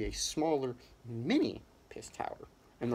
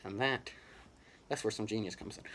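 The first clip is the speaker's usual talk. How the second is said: normal talking voice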